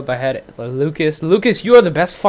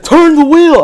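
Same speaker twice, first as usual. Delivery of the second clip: imitating dad's loud, high shout; exasperated shouted order, pitched way up; angry yell; 12 semitones higher, 10 dB louder than usual. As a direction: yell it like an impatient driving instructor. Retold driving quarrel, dad yelling.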